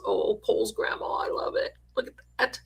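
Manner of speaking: breathy whispered aww; soft whisper. Real, not acted. Host cooing over Cole's grandma, whispering. Tender reaction to a gift.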